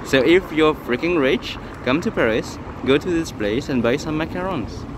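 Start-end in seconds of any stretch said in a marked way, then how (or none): none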